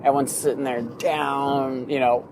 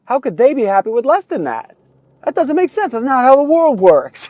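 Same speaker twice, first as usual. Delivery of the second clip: high, loud, exasperated rant; rising outrage that peaks high and loud; playing the jealous bystander, getting mad at a happy amputee, louder and higher; mock indignation; 9 semitones higher, 7 dB louder than usual. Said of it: "we get mad 'cause we're like".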